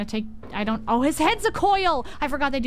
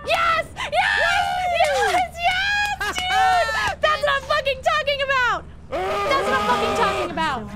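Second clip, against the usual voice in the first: screaming yes at full voice, much higher and louder; triumphant cheering; 13 semitones higher, 4 dB louder than usual. Real, not acted. Streamer cheering buddy Ethan's escape, huge triumphant yelling. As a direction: let it all out like your teammate just won it.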